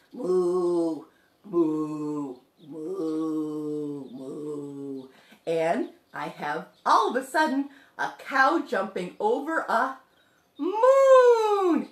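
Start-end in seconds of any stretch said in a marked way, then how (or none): 0.1-5.1 cow puppet mooing. Pretend. Puppet introducing itself to children, low cow mooing. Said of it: "this little puppet that says". 10.6-11.9 "moon" high, loud and stretched; drawn-out "moon". Shown as a demonstration. Revealing what cow jumps over, "moon" stretched high. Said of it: "Not moo, moon".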